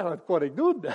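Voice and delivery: normal talking voice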